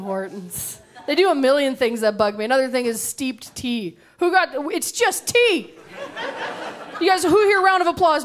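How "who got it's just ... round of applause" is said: mock outrage. Real, not acted. Mocking steeped tea to audience.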